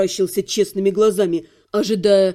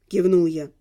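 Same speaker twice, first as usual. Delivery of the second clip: plain reading voice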